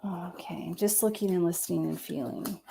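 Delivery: hushed whisper while concentrating. Real, not acted. Psychic reader focusing on the cards in a whisper. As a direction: breathe the words as you tune in.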